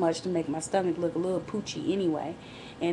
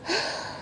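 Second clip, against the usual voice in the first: long sigh without voice; weary audible sigh; heavy sigh; 13 semitones higher, 4 dB quieter than usual. Real, not acted. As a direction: let out a big exhale. Sigh after retold pity party.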